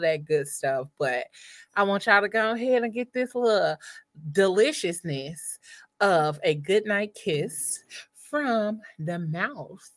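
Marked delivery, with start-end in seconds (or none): 1.7-9.9 goodnight kiss. Acted. Introduces goodnight kiss segment.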